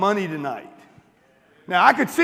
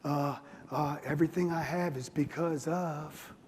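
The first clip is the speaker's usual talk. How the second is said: a hint of a meek, softer humble bragger; mock humble brag; 5 semitones lower, 9 dB quieter, slower than usual. Mocking the audience's humble brag, in a lightly voiced meek tone. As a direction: play the falsely modest one, quieter. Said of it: "humble brag thing".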